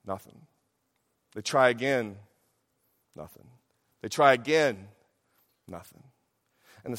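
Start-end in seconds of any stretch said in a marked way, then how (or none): 0.1-0.5 low, hushed letdown; deflated. Retold or imitated. Preacher telling of Abraham and Sarah's failed tries, deflated. 3.1-3.5 very low and hushed; deflated. Retold or imitated. Preacher telling of Abraham and Sarah's failed tries, deflated. 5.7-6.0 very low and hushed; deflated. Retold or imitated. Preacher telling of Abraham and Sarah's failed tries, deflated.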